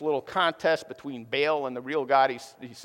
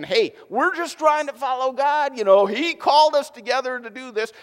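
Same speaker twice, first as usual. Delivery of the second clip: higher, louder mock pleading; whiny excuse; 9 semitones higher, 7 dB louder than usual. King of Israel blaming God, whiny and higher. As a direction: play the guilty king passing the blame.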